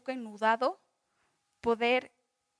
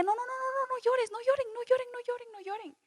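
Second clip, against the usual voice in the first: much higher-pitched, rapid string of pleas; súplica de consuelo; 11 semitones higher than usual. Acted. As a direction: hush a weeping crowd in a high, urgent voice. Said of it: "Y Nehemías decía". (súplica aguda e insistente para que dejen de llorar)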